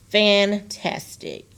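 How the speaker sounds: slow, syllable by syllable; broken into syllables. Shown as a demonstration. Teacher to class, chopping fantastic into slow syllables. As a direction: stretch fantastic out into its three parts. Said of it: "see how I broke that up?".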